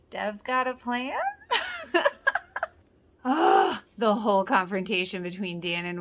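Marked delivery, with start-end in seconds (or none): 1.2-2.7 long, very high laugh that cracks; high laughing squeal. Real, not acted. Laughing about Deb having a plan, a high squeal.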